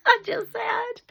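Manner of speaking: high, helpless laughing speech. Real, not acted. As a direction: struggle to talk through real laughter. Laughing while introducing the story.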